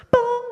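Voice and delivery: high, loud "boom" tone; short high-pitched mock PA ding; imitated intercom chime. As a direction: sing out a page-system beep.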